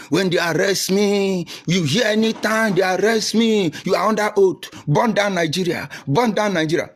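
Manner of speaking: mock rabble-rouser shouting orders from a cell; mocking agitator. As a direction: ham up a fired-up agitator ordering followers. Mocking agitator's call to arms.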